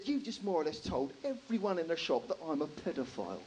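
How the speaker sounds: ordinary talk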